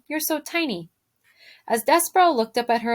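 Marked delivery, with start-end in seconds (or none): 0.1-0.9 lightly voiced princess, a bit higher and softer. A narrator's reading. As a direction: coo it like a fond princess. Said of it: "said the princess".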